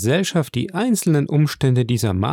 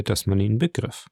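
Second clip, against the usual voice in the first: usual speaking voice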